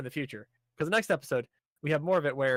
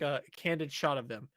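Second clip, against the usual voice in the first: ordinary talk, nothing marked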